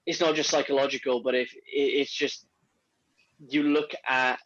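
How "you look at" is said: normal talking voice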